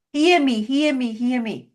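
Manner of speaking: imitated loud, repeated demand. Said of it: "you start going loud, loud".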